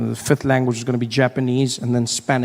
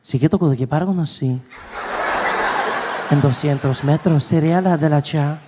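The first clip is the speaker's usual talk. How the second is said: Spanish GPS voice. Retold or imitated. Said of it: "She's like".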